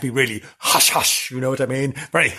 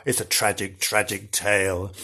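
spooky storytelling; 7 semitones lower, 6 dB quieter than usual. Pretend. Dramatic low ghost-story telling.

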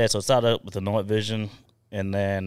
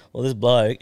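normal talking voice

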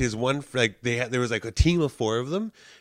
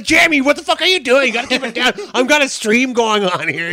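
high, loud mock tirade; hammed-up angry scolding; berating girlfriend; 12 semitones higher, 12 dB louder than usual. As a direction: imitate a girlfriend bursting in yelling. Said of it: "berating him".

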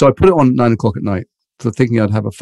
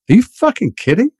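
incredulous outburst; 11 semitones higher than usual. Retold or imitated. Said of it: "And they went".

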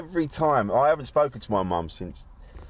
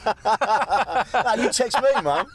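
laughing mock plea; 12 semitones higher, 4 dB louder, much slower than usual. Mock reply to mum, laughing.

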